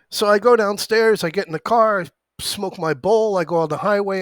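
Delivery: pumped, rattling off the celebration. Real, not acted. Telling viewers how he celebrated, loud and pumped. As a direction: ride the thrill up high.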